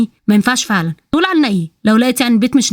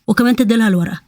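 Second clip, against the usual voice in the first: plain reading voice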